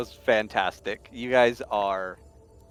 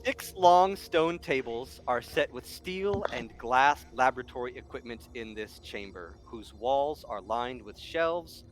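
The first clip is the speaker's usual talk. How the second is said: scene narration; 6 dB quieter than usual. A narrator's reading. GM reads scene text.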